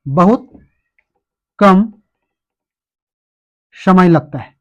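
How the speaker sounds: "बहुत", "कम" and "समय लगता है" spoken as separate chunks with long pauses; slow dictation. Shown as a demonstration. Teacher dictating a definition to students, slow and spaced. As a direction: spell it out slowly so students can write. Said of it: "परिभाषा भी लिख लीजिए".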